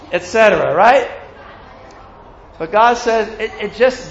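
ordinary talk

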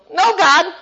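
high, whiny no to God; mock petulant refusal; childish defiance. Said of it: "when we go".

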